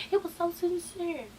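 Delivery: high, very soft squeak; mock gushing. Vlogger commenting on a sincere reunion, high soft gushing. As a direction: coo it up high and quietly, mock-touched.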